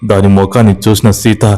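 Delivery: plain reading voice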